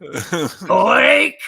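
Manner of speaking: loud Yiddish grunt in a put-on voice; Yiddish accent. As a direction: bark it like a Yiddish Klingon. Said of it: "thick Yiddish accent".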